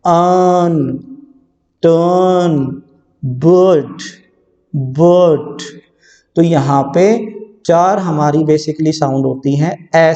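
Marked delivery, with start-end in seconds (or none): 0.1-5.6 un, turn, but said slowly with a clear vowel; model vowel words. Shown as a demonstration. Teacher drilling vowel words for the class, slow and careful. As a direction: stretch "टर्न" out slowly. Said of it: "ये भी ए sound है".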